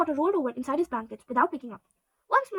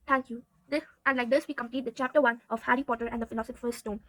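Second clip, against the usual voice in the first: usual speaking voice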